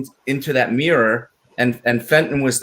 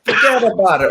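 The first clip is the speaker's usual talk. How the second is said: mock mobster; 6 semitones higher, 4 dB louder than usual. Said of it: "He's like".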